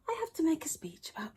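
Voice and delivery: whispered worry. Acted. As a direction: breathe it out, scared and secret. Frog repeats his worry.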